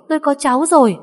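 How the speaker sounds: even bigger joyful shout. A narrator's reading. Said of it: "hét lớn".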